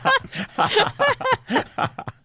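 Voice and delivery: high burst of real laughter; big laugh. Real, not acted. Laughing at his own airport story, high burst of laughter. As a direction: let the laugh break out high.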